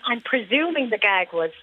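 ordinary delivery

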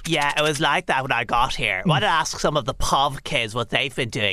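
low, sneering and superior; snobby sneer. Acted. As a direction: look down on everyone. Snobby kid sneers at toys.

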